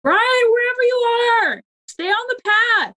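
high, slow, carrying call. Acted. Warning Brian lost in the corn, calling out slowly. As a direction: call out so he hears across the field.

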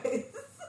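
high squeaky held-in giggle; a giggle escaping despite trying; stifled giggle. Real, not acted. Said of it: "I'm trying not to laugh".

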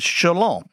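like a rabbi blessing his students, "Shalom"; Hebrew greeting. Retold or imitated. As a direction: give "Shalom" as a rabbi's greeting, stress on LOM.

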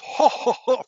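high, loud mock ho ho ho; booming laugh imitation. Mimicking the guy laughing in the audience, booming. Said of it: "we hear that guy's laugh".

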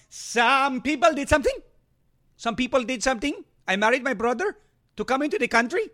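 a hint of the clumsy alien; Jar Jar Binks voice. Host mocking a congresswoman as Jar Jar. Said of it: "calls her Jar Jar Binks".

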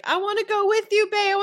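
high, rushed mock wail; imitated crying. Father crying after his lost son, imitated crying. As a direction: playact a desperate tearful plea. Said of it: "when he was crying".

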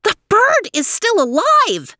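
excited. Acted. Theo startled.

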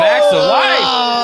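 drawn-out excited call; excited announcement. Acted. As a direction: announce it like a game show. Announcing the rival show.

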